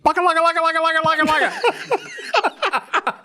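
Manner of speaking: high-pitched frantic jabbering; frantic gibberish. Retold or imitated. Man bursting from the kill floor, frantic jabber. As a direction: imitate a panicked foreigner babbling nonsense syllables.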